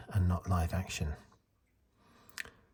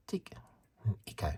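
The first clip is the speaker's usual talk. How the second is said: New Zealand vowels in "Tick and Echo"; imitated New Zealand accent, "Echo" as "Icko"; 5 dB quieter than usual. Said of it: "but she calls them".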